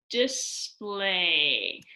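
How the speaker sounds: "display" stretched out in two slow syllables; sounded out. Shown as a demonstration. Teacher finding syllables in a word for pupils, "display" sounded out slowly. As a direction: draw out "dis-play" syllable by syllable. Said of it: "find syllables by clapping out a word".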